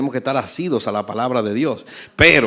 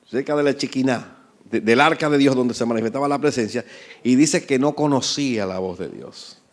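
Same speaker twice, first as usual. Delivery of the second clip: ordinary talk, nothing marked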